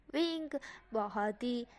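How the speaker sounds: ordinary delivery, nothing marked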